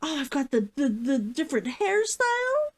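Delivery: usual speaking voice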